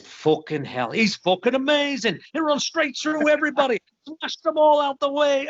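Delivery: put-on retelling an Irish fan's rave in an Irish accent; excited Irish accent. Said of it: "Pardon the accent".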